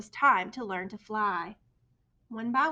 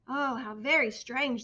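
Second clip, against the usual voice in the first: plain reading voice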